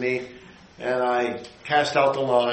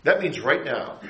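ordinary talk, nothing marked

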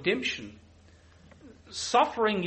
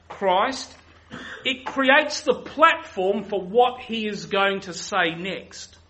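usual speaking voice

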